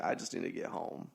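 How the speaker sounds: low, soft weary mumble; drained and understated; exhausted, retold. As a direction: say it as if worn out. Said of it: "I was exhausted".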